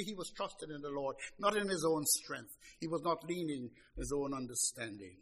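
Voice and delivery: usual speaking voice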